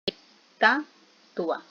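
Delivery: "estatua" stretched very slowly, syllable by syllable es-ta-tu-a; split into syllables. Shown as a demonstration. Teacher showing the class how syllables split, "estatua" said slowly syllable by syllable. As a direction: spell out "estatua" slowly, each syllable apart. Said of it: "al separarla en sílabas nosotros decimos".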